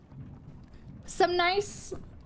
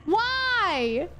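frustrated yelling; 7 semitones higher, slower than usual. Real, not acted. Game rage at the controls.

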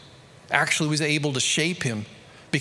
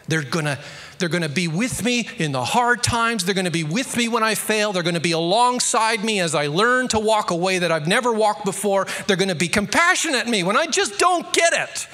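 rising from firm to a near-shouted climax, higher and louder; building preach; 6 semitones higher, 5 dB louder than usual. Real, not acted. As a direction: build each line until you are shouting.

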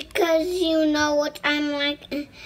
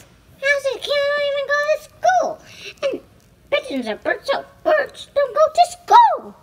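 put-on toddler voice; 12 semitones higher, 4 dB louder than usual. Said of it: "that's my little toddler voice".

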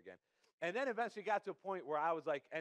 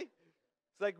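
ordinary talk

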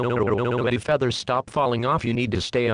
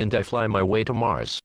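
ordinary talk

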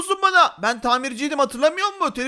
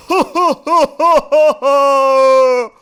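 loud, long theatrical laugh; loud hammed-up villain laugh; booming laugh; 9 dB louder than usual. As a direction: let out slow, heavy ha-ha-has. Villainous laugh startles the characters.

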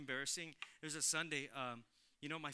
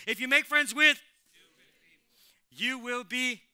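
much louder and higher, leading the crowd; shouted together; 7 semitones higher, 17 dB louder than usual. Real, not acted. Preacher leading the congregation in unison, loud shout. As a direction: shout it out with the whole room. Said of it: "Let's say this together".

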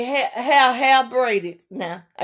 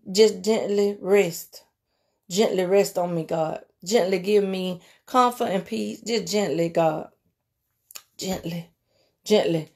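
slow, soft, lower prayer; gentle calming prayer; 3 semitones lower, 5 dB quieter than usual. Real, not acted. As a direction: ease them down slowly and gently.

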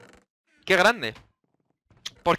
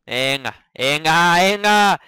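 building urging; 7 dB louder, much slower than usual. Real, not acted. Urging the game along.

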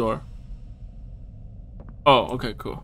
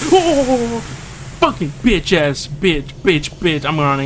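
loud frantic yelling while running; panicked shouting; 8 semitones higher, 8 dB louder, slower than usual. Real, not acted. Player fleeing the monster, panicked shouting. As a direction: scream it out as you flee.

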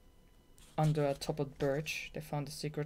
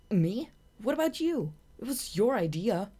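high, loud indignant protest; 7 semitones higher, 7 dB louder than usual. Acted. Shade blaming Marina for the contest, high loud protest. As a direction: throw the blame right back.

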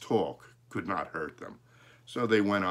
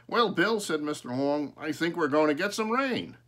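farmer's voice; 7 semitones higher, 6 dB louder than usual. Acted.

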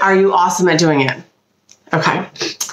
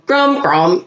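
ordinary delivery

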